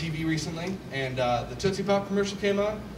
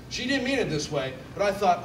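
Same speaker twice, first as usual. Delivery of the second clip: usual speaking voice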